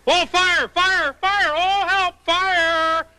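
high panicky calls of fire; fluttering cries for help. Shown as a demonstration. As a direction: wave arms and cry out for help. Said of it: "You call out very loudly".

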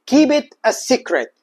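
very slow, every word drawn out; slow model phrase. Shown as a demonstration. Teacher introducing a new phrase, very slow. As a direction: spell the phrase out for the class. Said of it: "كل ما تريد أن تقول".

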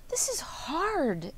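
slow, high exclamation of frustration; exclaiming. Acted. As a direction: stretch it out, pitched high, fed up. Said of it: "exclaimed Wilbur".

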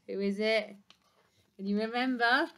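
ordinary delivery, nothing marked

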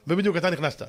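ordinary delivery

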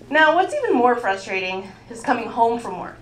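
usual speaking voice

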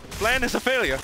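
plan fails, voice jumps high; high frustrated yelp. Real, not acted. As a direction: yelp it out in dismay. Sudden failure in fight.

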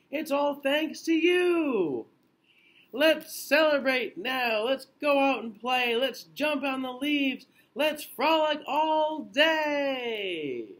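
high, loud happy bear exclaiming; cheerful storybook bear celebrating; excited bear. Acted. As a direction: bounce with joy at being well. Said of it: "Bear cries".

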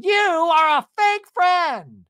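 slow, high, loud accusation; hammed-up rage, dragging out each word; mock furious yell. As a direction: imitate a reality star blowing up. Acting Tamara's confrontation clip.

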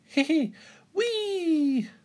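very high giggle into a long whee; tiny high-pitched voice, thrilled; gleeful child's squeal. A narrator's reading. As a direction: squeal with joy as you whoosh down a slide. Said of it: "cries George".

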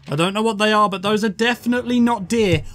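ordinary talk, nothing marked

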